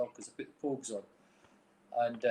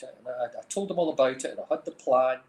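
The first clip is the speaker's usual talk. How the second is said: ordinary talk, nothing marked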